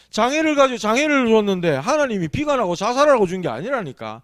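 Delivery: ordinary delivery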